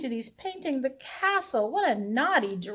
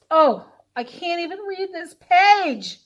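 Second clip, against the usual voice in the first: ordinary delivery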